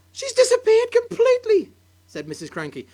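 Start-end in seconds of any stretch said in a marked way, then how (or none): 0.1-1.7 high, loud stunned cry; shocked cry. Acted. Wife in shock.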